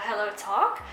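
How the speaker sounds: normal talking voice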